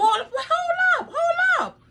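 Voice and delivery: high, drawn-out disbelief; stunned protest. Real, not acted. Mother halting the scholarship video, stunned. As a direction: stop them with a high, slow cry.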